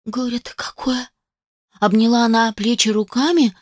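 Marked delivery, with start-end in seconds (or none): 0.0-1.1 higher, whispered wail of grief; keen it softly through tears; a maid lamenting her mistress in a whisper; tearful whispered lament. A narrator's reading. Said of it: "принялась причитать, обливаясь слезами".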